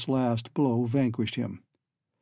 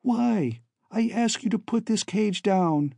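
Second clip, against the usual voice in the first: put-on higher young woman's voice; calling daughter; 8 semitones higher than usual. A narrator's reading. Daughter calls from the cage to be put down, pleading. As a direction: call out a plea in a higher voice. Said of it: "She called".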